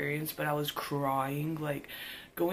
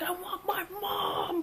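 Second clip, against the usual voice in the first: put-on high, slow, sobbing whine of a little kid; high drawn-out childish sob; mock crying child; slower than usual. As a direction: wail for mom like a scared seven-year-old. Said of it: "like, crying".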